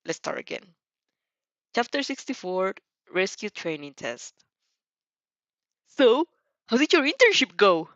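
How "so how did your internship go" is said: loud, high shout down the phone; booming shout. Acted. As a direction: blast it so the phone must be held away. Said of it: "how loud Inasa's voice managed to be".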